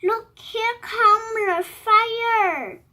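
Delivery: high, loud, slow storybook call; acted calling out. Monkey spots the fire engine, calling out slowly. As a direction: draw out an excited lookout's cry.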